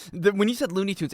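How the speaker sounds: ordinary delivery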